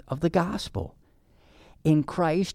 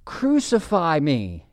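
higher, slow, drawn-out cry; 4 semitones higher, 4 dB louder, slower than usual. Acted. Preacher quoting the obedient Son offering himself, crying out. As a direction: cry it out slowly, moderately raised. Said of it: "cried the obedient Son".

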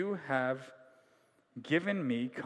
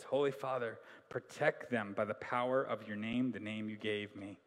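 soft prayer reading; 7 semitones lower, 6 dB quieter than usual. Acted. Reads Jesus' prayer softly.

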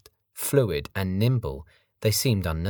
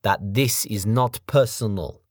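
menacing foreign thug; 3 semitones higher, 4 dB louder, slower than usual. Acted. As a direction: sneer it like a big brute. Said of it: "explained Sergei".

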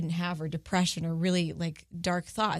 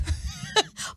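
airy laugh out of breath; breathless laugh. Real, not acted. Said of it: "I'm pregnant and I'm out of breath".

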